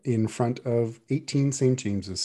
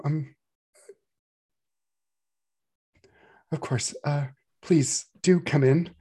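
higher, stammering flustered housewife; flustered mother; 5 semitones higher than usual. Acted. Mother greeting unexpected guests, flustered and stammering. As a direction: fumble for words, embarrassed hostess.

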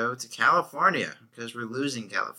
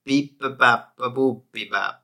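mock Italian gibberish; 6 dB louder than usual. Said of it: "You wanna hear my Italian?".

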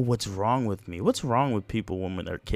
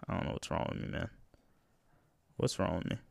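sighing mutter; 5 semitones lower, 10 dB quieter, much faster than usual. Real, not acted. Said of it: "sighing self-reproach".

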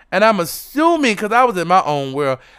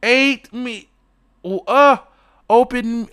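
normal talking voice

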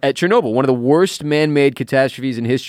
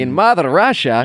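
higher-pitched, hammed-up Russian accent; joking mock-Russian voice; comic Russian accent; 4 semitones higher than usual. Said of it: "talking in a Russian accent".

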